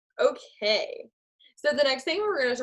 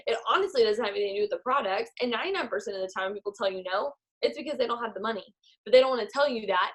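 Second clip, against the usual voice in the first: ordinary talk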